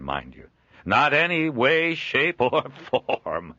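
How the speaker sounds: a jovial trader chuckling at his own likeness; Joel, amused trader. Acted. Joel gently insisting.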